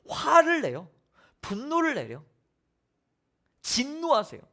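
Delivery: ordinary delivery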